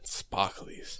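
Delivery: breathy whispered word. Acted. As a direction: whisper it like a secret. Whispering about nail sparkles.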